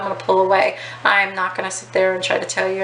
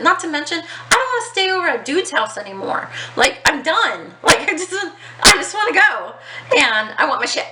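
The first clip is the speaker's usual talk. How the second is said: done with it all, pleading to leave; fed up; 8 semitones higher than usual. Real, not acted. Telling viewers she just wanted out, exasperated.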